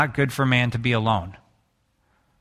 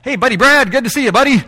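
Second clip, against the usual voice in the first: high, louder hammed-up "hey buddy" greeting; mock buddy greeting; 8 semitones higher, 5 dB louder than usual. Pastor parodies casual buddy greetings, high and loud. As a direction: overplay a pal slapping everyone's back, voice pitched up. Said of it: "it's kinda like".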